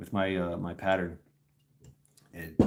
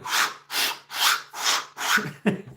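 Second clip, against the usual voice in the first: rhythmic breathy swish noises; corduroy swishing. Acted. Everybody hears him coming, rhythmic corduroy swishes. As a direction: imitate trouser legs rubbing, stride by stride. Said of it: "everybody heard you coming".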